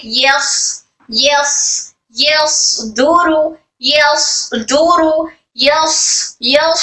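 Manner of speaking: "यस" ending in a long, heavy whistled s; airflow on saad. Shown as a demonstration. Teacher models saad with hams for students, "यस" with airy s. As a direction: let air hiss through the s of "यस", then "दूरू". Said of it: "सौद में air flow हो रहा है".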